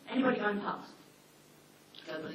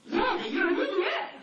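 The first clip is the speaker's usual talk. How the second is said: much higher, loud laughing jab; laughing shout; 10 semitones higher, 7 dB louder than usual. Retold or imitated. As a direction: tease with a shout. Mock retort to the dog.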